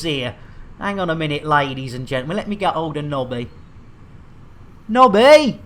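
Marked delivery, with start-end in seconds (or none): 4.9-5.6 loud, higher call across the ship. Acted. Captain calling his crewman Nobby over to help, loud call. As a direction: shout his name to fetch him.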